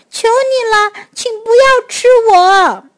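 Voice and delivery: high, loud puppy begging; crying out. A narrator's reading. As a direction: cry out a high-pitched plea. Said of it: "小狗大叫起来".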